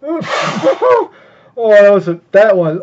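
laughing oh, high and loud; stung laughing groan; pained laugh. Real, not acted. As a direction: laugh it off in disbelief. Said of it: "Oh, that hurt".

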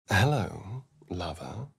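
a sultry murmur that fades to nothing; seductive low purr. Acted. Vampire greets his lover in a low seductive murmur. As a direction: drop low and soft on lover, teasing.

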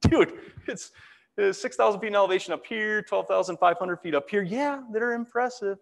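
high-pitched mock disbelief; reply as if stating the staggeringly obvious; incredulous retort. Retells his incredulous reply to coworker.

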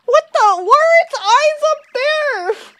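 horrified squeal; shocked. Real, not acted. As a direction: recoil at something creepy. Shocked at creepy creature.

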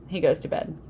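usual speaking voice

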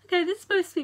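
high, laughing bemused reaction. Real, not acted. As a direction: say it through a laugh.

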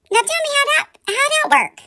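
high, mocking how that work; incredulous challenge. Real, not acted. Vlogger challenging Laura's contradiction, high scornful disbelief.

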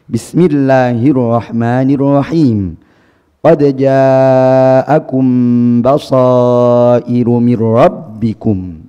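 very slow, drawn-out tahqiq recitation with stress before the end of Qad ja'akum basairu mir rabbikum; Arabic-style recitation. Shown as a demonstration. Teacher demonstrating Arabic-style recitation to students, slow and stressed. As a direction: recite slowly, pressing the syllable before the last as Arabs do.

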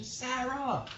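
high-pitched mock call, kept soft; imitated yell. Kid calls a friend across campus, imitated yell. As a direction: imitate a kid calling out without real volume. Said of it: "you know, yelling, you know, trying to get someone's attention".

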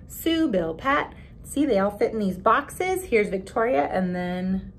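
usual speaking voice

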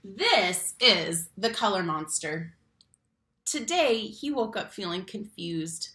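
lively, expressive picture-book reading; reading to children. Acted. Teacher reading a picture book to her class, expressive storytime voice. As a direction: read it like storytime for little kids.

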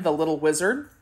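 ordinary delivery

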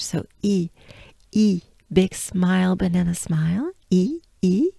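"E" said with a big banana smile, spread lips; wide-smile French E. Shown as a demonstration. French teacher showing learners the smiling E sound. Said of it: "big smile for the, to sound the letter I".

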